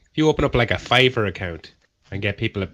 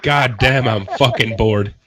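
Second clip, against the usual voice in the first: bored complaint; 3 semitones higher, 6 dB louder, slower than usual. Retold or imitated. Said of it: "You're like".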